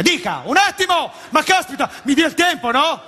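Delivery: a touch of a hurried cashier; impatient cashier. Retold or imitated. McDonald's cashier rushing the customer, mildly mocked. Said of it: "la signora mi diceva".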